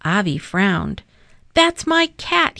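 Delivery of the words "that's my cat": high, loud shout of ownership. A narrator's reading. Boy shouting at another boy over the cat. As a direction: yell it, claiming the cat. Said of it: "he shouted".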